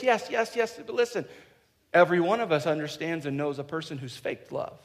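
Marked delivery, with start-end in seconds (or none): none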